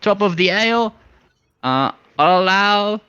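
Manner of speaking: a flighty little rascal egging them on; high excited bird voice. Acted. Coaxing the drunk sailors toward the warehouse, high and eager.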